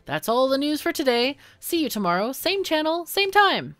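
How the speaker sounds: bright upbeat TV anchor; newscaster voice. Acted. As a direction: read it like the evening news anchor. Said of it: "my best newscaster voice".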